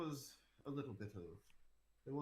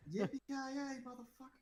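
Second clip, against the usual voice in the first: movie catchphrase; 13 semitones higher than usual. Retold or imitated. Quoting Die Hard catchphrase.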